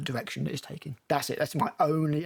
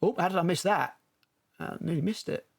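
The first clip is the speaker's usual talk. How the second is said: ordinary delivery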